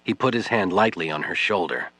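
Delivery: ordinary talk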